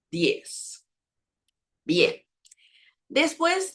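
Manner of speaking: ordinary talk, nothing marked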